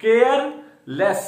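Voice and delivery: careless drawn out very slowly, each sound clear; teacher sounding out a new word slowly; slow careful careless. Shown as a demonstration. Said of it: "next word is careless".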